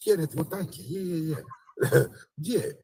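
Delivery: overly pleased to get the money; mock grateful beggar.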